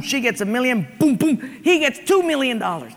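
usual speaking voice

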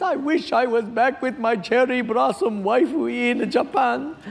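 mock Japanese accent for a joke character. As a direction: put on a broad Japanese accent. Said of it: "the Japanese guy says".

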